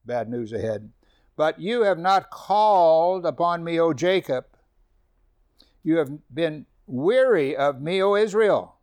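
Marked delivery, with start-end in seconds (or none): 1.3-8.8 slow, loud declaiming of scripture; preacher declaiming God's words to Israel; emphatic scripture reading. Acted. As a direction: read the verse like a proclamation from the pulpit. Scripture read with force.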